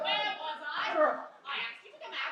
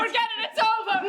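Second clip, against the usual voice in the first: loud give-up cry; exasperated; 8 dB louder than usual. Acted. Frantic actor to partner, loud exasperated outburst. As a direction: throw up your hands, loud. Backstage panic argument.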